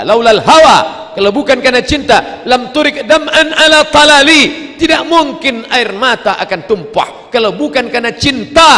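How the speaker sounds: high, loud preaching; impassioned declaiming. Real, not acted. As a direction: proclaim it at full force. Preacher forcefully declaims verse and translation.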